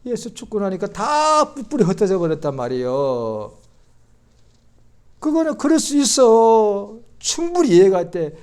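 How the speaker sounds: loud, high preaching, slowing into a shout; rising fervour, driving the point home; full-force sermon climb. Acted.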